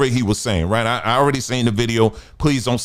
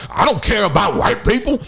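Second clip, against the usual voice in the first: high, shouted mocking of a critic's claim; mock indignant critic; 8 semitones higher than usual. Mocking a hypocrite who claims not to care, shouted high. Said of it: "you the first one there".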